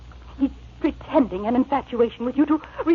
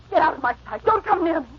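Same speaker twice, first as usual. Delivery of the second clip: ordinary talk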